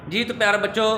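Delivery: usual speaking voice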